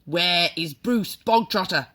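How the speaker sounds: loud, stern bellowed question; barking demand. A narrator's reading. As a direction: bellow it across the hall. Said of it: "the Trunchbull barked suddenly".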